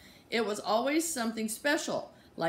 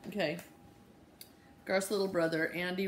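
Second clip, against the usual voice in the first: usual speaking voice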